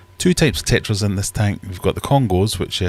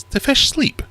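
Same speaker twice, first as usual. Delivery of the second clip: put-on much higher, curious child voice; kid's question; 4 dB louder, slower than usual. Kids' friends asking about the fish, in a high child voice. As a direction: pipe it up high like a little kid asking. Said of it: "it's always a question is".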